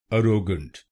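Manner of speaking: अरोगेंट with a flat first a and retroflex t; wrong Indian pronunciation. Shown as a demonstration. Teacher demos the common wrong way, अरोगेंट said ə-ro-gəɳʈ. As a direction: say अरोगेंट as uh-ro-gent, stressing ro. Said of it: "कुछ लोग India में इस शब्द को गलत बोलते हैं".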